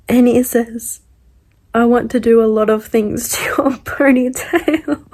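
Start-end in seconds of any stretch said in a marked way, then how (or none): 1.7-5.1 reading a quote, cracking up; loud start dissolving into laughter; laughing retelling. Retold or imitated. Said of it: "the amount of times I've laughed".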